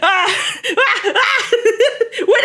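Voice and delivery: high, breathless flustered squeaks with laughter; flustered squeals. Retold or imitated. To Chancey, reliving being recognised, flustered laughing squeals. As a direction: freeze up and squeal when recognised. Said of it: "I was like".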